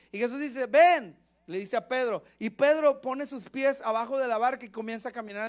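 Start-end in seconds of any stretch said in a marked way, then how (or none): 0.7-1.2 loud, high one-word summons; commanding call. Acted. Jesus calls Peter out of the boat, commanding call. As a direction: call out the order loudly.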